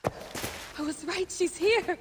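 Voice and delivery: excited discovery. Acted. Finding Aya's tomb.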